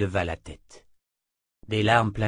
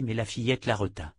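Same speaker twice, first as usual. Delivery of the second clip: plain reading voice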